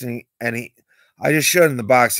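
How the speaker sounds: usual speaking voice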